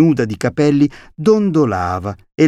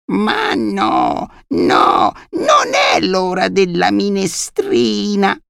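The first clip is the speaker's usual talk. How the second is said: high, drawn-out deaf granny voice; muttering old grandma; 8 semitones higher, much slower than usual. A narrator's reading. Deaf grandmother answering her grandson about soup, high old-woman muttering. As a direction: play a decrepit granny, high-pitched and slow. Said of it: "borbottò la nonna".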